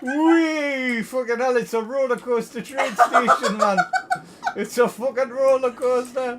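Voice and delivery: loud, high roller-coaster whoop; excited shouting. Real, not acted. Streamer watching the track go under, loud excited whooping. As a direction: shout it with a laugh in the voice.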